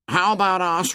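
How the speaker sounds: high, anxious schoolboy voice; timid Piggy. Acted. Piggy begging the boys to stay put, high and anxious.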